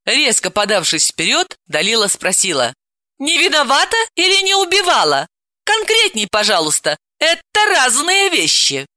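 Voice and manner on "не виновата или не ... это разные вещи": sharp, higher, pressing questions; demanding. Acted. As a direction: pin him down.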